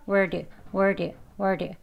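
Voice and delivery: "word" as where plus a tapped d; short "word" with a soft flapped ending; linked flap D. Shown as a demonstration. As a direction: run where and do together as "word". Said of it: "do가 아니고 D라는 소리예요".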